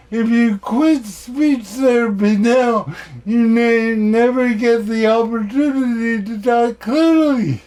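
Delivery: imitating an insistent objection; alarmed therapist. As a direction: plead like a worried therapist. Acted warning from his speech therapist.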